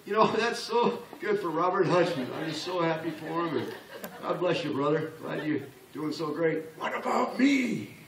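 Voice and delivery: laughing fake praise, then a high, slow whine; mock envious cheer turning to a petulant what about me; jealous audience member. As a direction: gush mockingly, then sulk. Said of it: "if I'm you in the audience".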